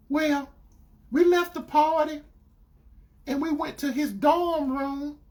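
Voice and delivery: put-on high, halting girlfriend voice; confessing girlfriend. Girlfriend confessing about the dorm room, imitated reluctantly. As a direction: imitate her reluctantly spelling it out.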